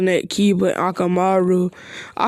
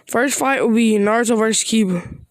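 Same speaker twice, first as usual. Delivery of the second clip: normal talking voice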